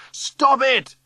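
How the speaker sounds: high, loud cry of protest; shouting. A narrator's reading. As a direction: shout desperately to halt the mob. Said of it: "I'm shouting".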